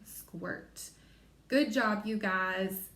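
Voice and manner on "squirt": squirt whispered very softly. Shown as a demonstration. Teacher whispering the word after spelling it. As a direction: breathe squirt in a hush. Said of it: "So we spell squirt".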